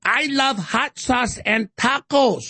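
Kamala Harris. Acted.

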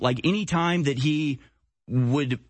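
normal talking voice

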